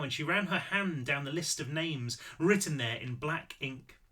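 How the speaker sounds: plain reading voice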